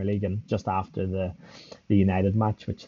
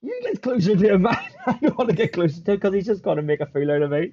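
high, loud comic protest; protesting back; 9 semitones higher, 3 dB louder than usual. Retold or imitated. Player refusing teammates' order, high mock protest. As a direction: throw it back at them, pitched way up. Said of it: "I'm like".

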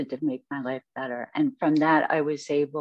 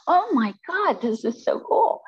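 excited realization; 12 semitones higher, 3 dB louder than usual. Retold or imitated. Sudden realization exclaimed.

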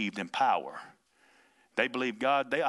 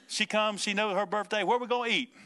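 much higher, eager mimicry; Ruthie; 7 semitones higher than usual. Preacher imitating a girl asking about her birthday meal, eager. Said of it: "See, that's Ruthie right there".

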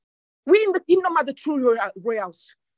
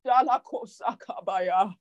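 speaking in tongues; 6 semitones lower, 5 dB quieter, slower than usual. Real, not acted. Preacher breaks into tongues mid-sermon.

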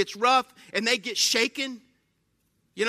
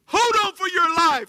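higher, louder alarmed yell; captain yelling warning; 8 semitones higher, 7 dB louder than usual. Retold or imitated. Captain yelling at passengers to brace, high and loud. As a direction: shout the warning like a panicked pilot. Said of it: "And then the captain goes".

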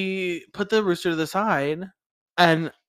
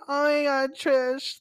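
higher camp voice with a laugh in it; campy judge; 10 semitones higher, slower than usual. Retold or imitated. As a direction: flamboyant gush over Trish.

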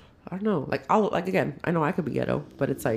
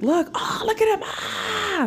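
much higher excited cry; hyped squeal. Retold or imitated. Showing her cousin the dancers, high excited squeal. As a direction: point and squeal with glee. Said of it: "And I was like".

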